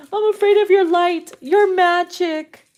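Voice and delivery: mocking imitation. Repeats it in a mocking voice.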